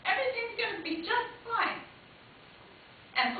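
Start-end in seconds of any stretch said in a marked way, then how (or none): 0.0-1.8 put-on little boy comforting. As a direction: soothe his mother like a small boy. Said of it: "my son put his hand on my shoulder".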